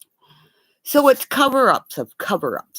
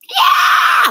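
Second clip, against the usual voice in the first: excited shout; 8 dB louder than usual. Real, not acted. Excited outburst on livestream.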